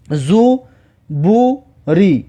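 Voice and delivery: slow, with clear gaps between syllables; syllable-by-syllable reading. Shown as a demonstration. Teacher shows learners the first letter, slowly splitting the word. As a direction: break "زوبوری" into three separate syllables. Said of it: "در اول کلمه حرف زا است".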